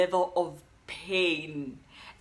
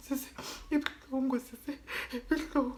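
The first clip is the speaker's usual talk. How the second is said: high soft pained whimper; pleading whimper; 5 semitones higher, 7 dB quieter, faster than usual. Retold or imitated. To the nurse in labor, high whimpering plea. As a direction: beg the nurse in a small hurt voice. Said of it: "I'm like".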